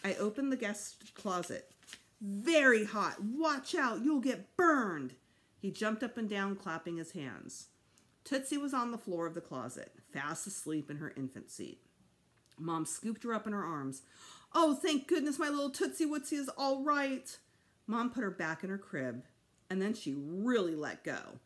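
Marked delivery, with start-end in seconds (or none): 2.2-5.2 loud, slow, stretched-out warnings; gleeful child building suspense; excited. A narrator's reading. Little boy as seeker reaches closet, excited. 14.5-17.4 put-on high, loud baby-talk relief; relieved gushing. A narrator's reading. Mother scooping up found baby, relieved gushing.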